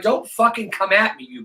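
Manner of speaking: snapping back. Retold or imitated. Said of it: "But I'm like".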